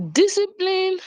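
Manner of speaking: mock disbelief. Repeating the word in disbelief to the audience. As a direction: echo the word as if you can't believe it. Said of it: "I feel like".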